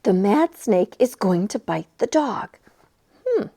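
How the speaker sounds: usual speaking voice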